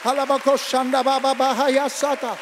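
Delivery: fast, loud, ecstatic tongues; loud glossolalia, high and driving; speaking in tongues. Real, not acted. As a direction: pour out tongues in fervour.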